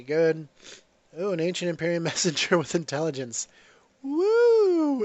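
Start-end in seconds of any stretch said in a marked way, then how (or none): 4.0-5.0 high, loud whoop of delight; excited whoop. Real, not acted. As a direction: cheer at the lucky drop. Excited cheer at good loot drop.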